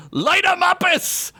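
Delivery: high slow spell shout. Acted. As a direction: cast it out, slowly. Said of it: "and shouted".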